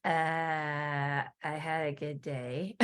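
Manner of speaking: put-on slow, low, soft deadpan teen reply; a flat, unbothered teenager; teen daughter deadpan. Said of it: "And she's".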